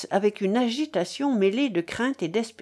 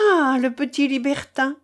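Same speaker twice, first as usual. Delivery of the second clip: exclaiming; 5 semitones higher than usual. A narrator's reading. Lady hearing of the absent gentleman, exclaiming. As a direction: cry out at the news of the absent man. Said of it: "s'écria Don Aximena".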